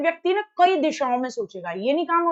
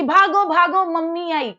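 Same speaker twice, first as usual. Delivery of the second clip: alarmed warning; 4 semitones higher, 5 dB louder than usual. Retold or imitated. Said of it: "कि".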